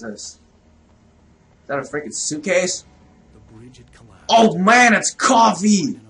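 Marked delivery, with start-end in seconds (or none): 4.3-6.0 high, loud and drawn out; excited exclamation. Real, not acted. Gamer spotting coffee in the game, excited. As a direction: cry out in delight at the find.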